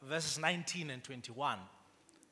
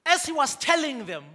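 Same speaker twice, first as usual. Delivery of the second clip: louder, higher, impassioned sermon delivery; preaching at full force; 8 semitones higher, 9 dB louder than usual. Acted. Pastor rises to full force telling Joseph's story. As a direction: drive the message home with fire.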